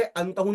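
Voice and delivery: अंतहू begun with "an"; initial short a. Shown as a demonstration. Teacher rules out a misreading, अंतहू with initial "a". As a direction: say अंतहू with an "a" as first vowel. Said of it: "नहीं कह सकते".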